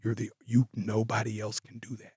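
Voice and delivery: quietly imitating Paul Heyman's dead-serious words. Paul Heyman telling [name] backstage only he can do it, softly serious. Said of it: "He looks at me so serious".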